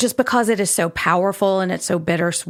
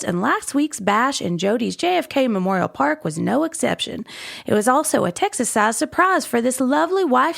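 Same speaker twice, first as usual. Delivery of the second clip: a hint of a folksy Texas columnist, higher; light Texas accent; 4 semitones higher than usual. Co-host reading the column in a light Texas accent. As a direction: read it like a small-town Texas gossip column. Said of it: "maybe I'll do a little Jody accent".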